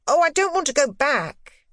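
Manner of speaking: put-on high, loud boyish interruption; boy breaking in. A narrator's reading. Boy interrupting his governess, high and eager. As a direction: cut her off eagerly. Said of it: "he broke in".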